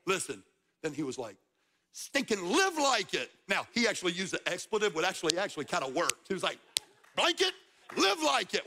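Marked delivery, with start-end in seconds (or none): 1.9-3.3 slower, higher exclamation with a punched LIVE; emphatic punchline. Retold or imitated. Preacher retelling a man's punchline with expletive softened, emphatic. 7.2-8.7 high-pitched mock exclamation; emphatic punchline. Preacher quoting a man's expletive-laced advice, emphatic.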